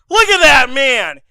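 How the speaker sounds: ordinary talk, nothing marked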